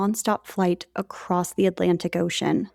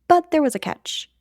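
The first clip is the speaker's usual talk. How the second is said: ordinary talk, nothing marked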